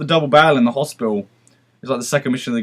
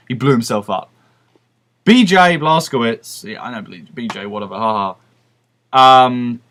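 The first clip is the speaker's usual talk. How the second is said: ordinary talk, nothing marked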